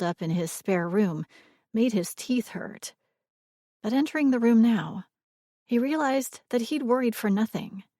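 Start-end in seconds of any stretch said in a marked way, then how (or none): none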